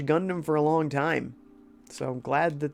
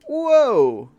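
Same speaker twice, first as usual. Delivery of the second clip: loud high startled whoa; surprised whoa; 9 semitones higher, 8 dB louder than usual. Real, not acted. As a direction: caught off guard. Sudden game event.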